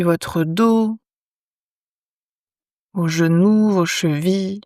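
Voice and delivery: calm yoga nidra guidance with long pauses; unhurried, measured phrases, leaving silence after each instruction; guided meditation. Acted. Teacher guiding lying listeners into yoga nidra, calm meditation voice.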